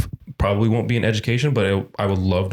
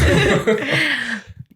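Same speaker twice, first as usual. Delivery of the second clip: squealing laugh; high burst of laughter; 3 dB louder than usual. Real, not acted. Laughs at own joke.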